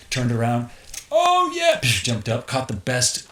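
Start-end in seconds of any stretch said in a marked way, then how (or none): 1.1-1.8 high, loud joyful whoop; stoked. Retold or imitated. Surfer catching the best wave, loud high whoop. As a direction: cheer as the wave lifts you.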